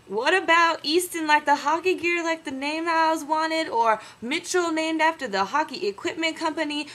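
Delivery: pleading suggestion. Retold or imitated. He pitches names to Bristol, high and eager. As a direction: beg for the hockey names. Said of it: "I was like".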